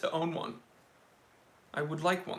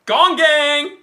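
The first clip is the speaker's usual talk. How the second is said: loud, high-pitched battle cry; rallying shout; 9 semitones higher, 12 dB louder than usual. Real, not acted. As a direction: yell it out to rally the gang. Battle cry after hitting gong.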